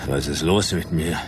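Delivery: groggy confusion, words coming in broken pieces; drugged and fading. Acted. Drugged man questions the woman about the whisky, woozy and faltering.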